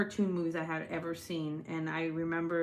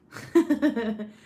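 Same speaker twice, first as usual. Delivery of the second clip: loud, higher villainous laugh; 4 semitones higher, 9 dB louder than usual. Real, not acted. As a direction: burst out cackling like a villain. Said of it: "Now I really do sound like a villain".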